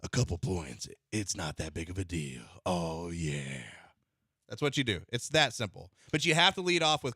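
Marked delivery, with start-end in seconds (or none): 0.0-3.6 deep booming crooner voice; Kool-Aid Man voice. Pretend. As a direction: lower it and croon the words smoothly. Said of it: "the Kool-Aid Man voice he had going".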